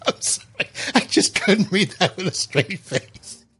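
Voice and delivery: laughing. Real, not acted. Apologises after laughing fit.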